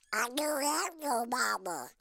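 sad little cartoon duck; high, slow, squawky duck voice; Yankee Doodle. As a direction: squawk it sadly like an orphaned duckling. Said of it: "looked up sadly and said".

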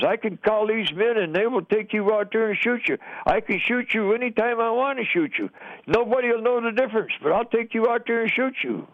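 worked-up retelling of a raging threat; retold angry screaming. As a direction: imitate a mad interrogator's threat without shouting. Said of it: "he was screaming this".

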